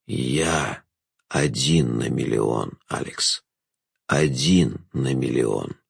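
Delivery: very low, soft, slow voice; low, sombre confession; grave Jester. A narrator's reading. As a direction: weigh out each phrase low and softly. Jester reveals himself.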